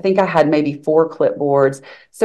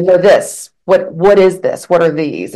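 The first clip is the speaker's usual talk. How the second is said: mildly imitating a confrontational staffer, louder at the start; raised-voice imitation of someone demanding answers; retold aggressive questioning; 3 semitones higher, 6 dB louder than usual. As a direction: fire off retold, accusing questions. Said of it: "in a very aggressive manner".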